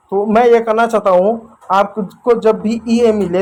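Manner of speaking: normal talking voice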